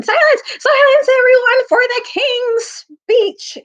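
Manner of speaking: high, loud squeaky cry for silence; flustered herald yelling for order; squeaky shout. Acted. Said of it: "running to and fro and saying".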